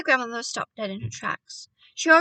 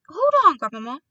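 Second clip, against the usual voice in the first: loud, urgent interruption; crying out; 4 semitones higher, 9 dB louder, faster than usual. A narrator's reading. Boy stopping his grandmother, crying out. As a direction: call out to halt her, voice raised. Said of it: "I cried".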